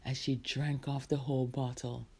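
ordinary delivery, nothing marked

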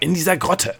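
high, insistent exclamation; sudden outburst. A narrator's reading. Gnome pressing the girl about the grotto, bursting out. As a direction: keep the outburst going, pitched high. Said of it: "brach es plötzlich aus ihm hervor".

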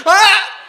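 short, high, very loud imitated yelp; sudden comic shriek; mock startled scream. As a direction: yelp like someone just jabbed. Imitates son being jabbed.

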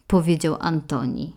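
usual speaking voice

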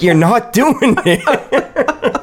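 high, slow, laughing protest; telling her she's not doing the noise right, laughing. Retold or imitated. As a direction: wheeze it out through the laughter. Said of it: "I'm like".

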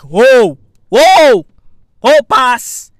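loud, booming mock whoas; punchy hammed-up calls, each thrown out loud; acted challenge. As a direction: bluster loudly to face down a dog. Acts squaring up to dog.

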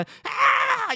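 very high, loud mock scream; imitated child's yelling. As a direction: yell like a bawling child. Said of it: "又边吼边叫". (模仿小孩边吼边叫)

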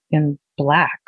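usual speaking voice

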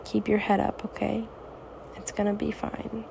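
normal talking voice

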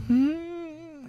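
put-on high small girl's voice; little girl. Girl's voice in ghost story.

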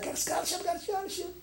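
high, breathless hushed excitement; a boy bursting with news, half-breathed; excited boy. Retold or imitated. Young son telling his mom about the scholarship, high and hushed. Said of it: "went to my mom and said".

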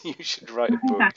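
amused high-pitched quote; laughing. Retold or imitated. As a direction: laugh while quoting the eager fans. Quoting fans, laughing mid-quote.

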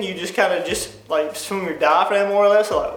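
ordinary delivery

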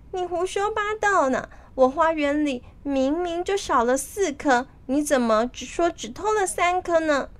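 high-pitched indignant protest; indignant accusation. A narrator's reading. Fruit guardian disputing the count with Monkey King, indignant. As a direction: accuse without raising the volume.

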